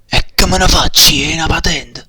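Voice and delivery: loud indignant retort. Acted.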